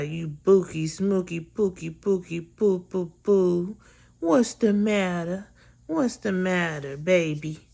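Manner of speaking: teasing pet-name coo, "pookie poo"; mock baby talk. Sister fussing over her sick brother in imitated baby talk.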